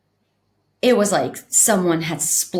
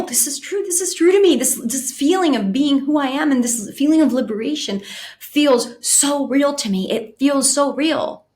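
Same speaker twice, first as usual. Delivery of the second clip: rising, higher and more urgent, insisting it is real; insistent protest; 6 semitones higher than usual. Retold or imitated. Retelling her own protest on the trip, urgent and rising. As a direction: plead that the feeling is true, climbing higher. Retold protest to herself on acid.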